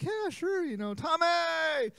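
put-on old man voice. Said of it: "And he's like".

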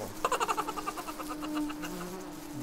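high, long cackling laughter; flies laughing. A narrator's reading. Fly friends mocking Morris, laughing hard. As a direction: laugh harder and harder. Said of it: "laughed all the more".

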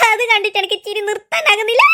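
laughing through the words; can't stop laughing while saying it. Acted. Aunt laughing at boy. (ചിരിച്ചുകൊണ്ട് പറയുന്നു)

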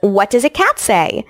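ordinary talk

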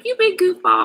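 high, loud mock-teasing friend. Host imagines her best friend seeing this, playful high teasing.

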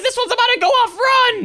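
high, urgent warning cry. Acted. Warning the guys of an explosion, urgent. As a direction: sound the alarm, pitch climbing. Warns viewers of coming explosion.

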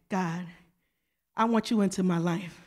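low, soft acted prayer; quiet prayer. Believer inviting God into their life, low quiet prayer. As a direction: pray it humbly, low and quiet.

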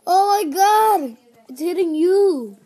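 slow, alarmed, high; scared horror game streamer. Real, not acted. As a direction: panic over the attack. Zombie attacking in game.

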